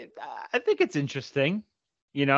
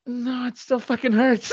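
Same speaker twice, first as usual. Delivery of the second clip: higher, slow, whiny complaint; stubborn sister; 8 semitones higher, slower than usual. Retold or imitated. A hint of the sister telling the healer it still hurts. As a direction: drag out the flat refusal. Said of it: "Oscar performance".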